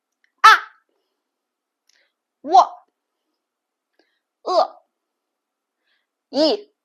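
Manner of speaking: each syllable struck high and falling sharply; falling fourth tone. Shown as a demonstration. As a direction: snap each syllable down like a defiant teen. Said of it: "you just like a teenager".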